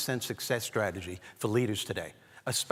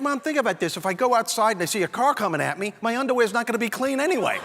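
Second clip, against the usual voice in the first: reasoning son; 9 semitones higher, 5 dB louder than usual. Retold or imitated. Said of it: "I'm like".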